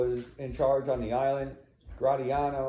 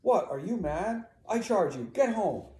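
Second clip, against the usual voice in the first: high, raised incredulous order; angry command; 8 semitones higher, 3 dB louder than usual. Acted. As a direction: order the wife away. Iago orders his wife home.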